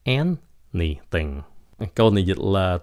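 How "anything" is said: ANYTHING slow and lower, stress on AN; first-syllable stress. Shown as a demonstration. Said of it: "Nhấn cái âm đầu". (nhấn âm đầu "anything", chậm và trầm)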